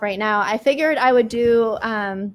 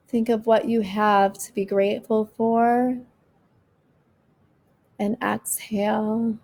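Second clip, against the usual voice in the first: slow, calm breathing guide; guided meditation. Acted. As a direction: ease them through each breath.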